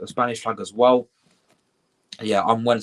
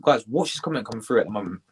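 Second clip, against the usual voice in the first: ordinary talk, nothing marked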